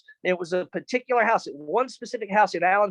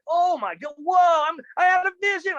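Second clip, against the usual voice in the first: excited realization; 10 semitones higher, 3 dB louder than usual. Retold or imitated. Realizing God answered him.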